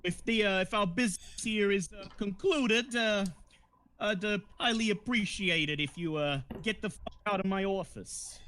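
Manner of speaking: stiffly polite crime boss dismissal; gangster boss role. Acted. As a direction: pitch it up, picking words haltingly. Vino dismisses them, in character.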